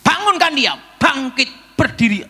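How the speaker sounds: high, loud divine command; voice of God commanding. Retold or imitated. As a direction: order him up with authority. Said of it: "Tuhan bicara sama saya".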